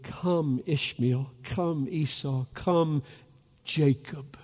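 usual speaking voice

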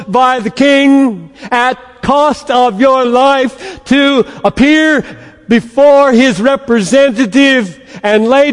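loud, slow royal proclamation; herald proclamation. Retold or imitated. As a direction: proclaim the king's decree. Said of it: "The herald standing in the town square".

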